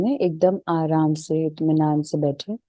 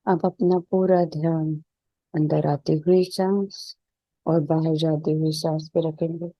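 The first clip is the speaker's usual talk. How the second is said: even, unhurried meditation voice with little pitch movement; settled, level relaxation-session delivery; guided meditation; 3 dB quieter than usual. Real, not acted. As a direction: guide them calmly into stillness, leaving space between phrases.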